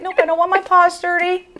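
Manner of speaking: high, fussy little princess; prissy dog voice. Retold or imitated. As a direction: voice a spoiled dog refusing mud. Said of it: "She's just like".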